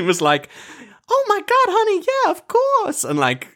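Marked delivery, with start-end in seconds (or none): 1.1-3.0 very high, hammed-up diva impression; badly imitated American celebrity voice; Tiffany Pollard. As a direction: imitate a gushing reality star, pitched way up. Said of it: "worst impression of Tiffany Pollard".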